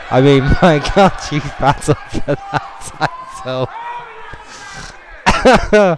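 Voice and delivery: high laughing words fighting through; laughing while talking. Real, not acted. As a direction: announce while cracking up.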